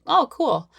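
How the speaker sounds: high, breezy mock shrug of a reply; a lightly voiced student who is not wowed; unimpressed oh cool. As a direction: act mildly unimpressed. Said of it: "they're like".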